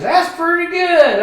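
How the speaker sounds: very high, loud, slow goofy voice; hammed-up tipsy delight; drunk dad pleased. Drunk dad to son over his winnings: high, goofy slow. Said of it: "He goes".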